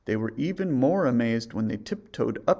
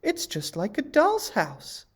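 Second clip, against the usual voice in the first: much higher, sweet and delighted; girlish wonder at a tiny house; 12 semitones higher, 4 dB louder, slower than usual. A narrator's reading. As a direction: coo over the cottage.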